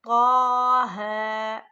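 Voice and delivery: तौ है drawn out slowly and loud; stretched recitation. Shown as a demonstration. Teacher showing how long to stretch तौ. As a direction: hold the vowel of तौ है, chanting it. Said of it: "इतना खींचेंगे".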